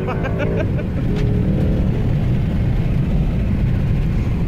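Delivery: loud nervous laugh; shocked laughter. Real, not acted. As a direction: laugh out in disbelief.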